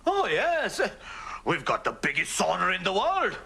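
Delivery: normal talking voice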